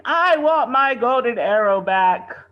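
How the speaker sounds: put-on loud, high, slow childish whine; spoiled lord throwing a tantrum; whining. A narrator's reading. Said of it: "stomping his feet and whining".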